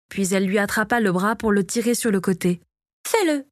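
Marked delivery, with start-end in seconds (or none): 3.1-3.4 high-pitched order, falling at the end; commanding. A narrator's reading. Victoria orders her eldest son, commanding. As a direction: order him to obey. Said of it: "lui ordonna-t-elle".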